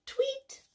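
tiny, soft, very high chirp; high bird tweet. A narrator's reading. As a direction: chirp it lightly like a little bird. Reads the bird's sound.

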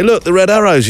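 high, eager imitation of a mum; excited mum. Mum points out planes to the kid, excited.

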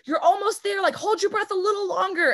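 shrill coach yelling at yourself; a high squeaky nagging push; pushy self-talk. Retold or imitated. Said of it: "you can either be like".

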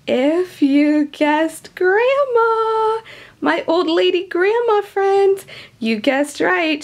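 high, slow, drawn-out announcing voice; sing-song reveal of a special guest; grandma reveal. Acted. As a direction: build up the big reveal of Grandma. Teacher voicing Grandma's arrival.

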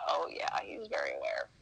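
whispered dry sarcasm; sarcastic whisper. Retold or imitated. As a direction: mutter it as an ironic aside. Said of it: "I said".

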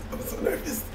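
a breathy, high whisper; whispered nerves before the reveal; nervous whisper. Real, not acted. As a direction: whisper it to yourself, jittery. Nervous before test result.

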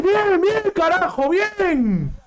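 ordinary talk

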